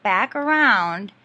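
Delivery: normal talking voice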